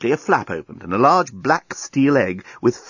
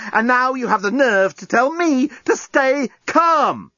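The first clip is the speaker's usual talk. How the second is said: higher voice, building to exasperation; scathing tirade; 8 semitones higher than usual. A narrator's reading. Lucy berates the journalist for advising calm, outraged. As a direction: build from sarcasm to high-pitched outrage.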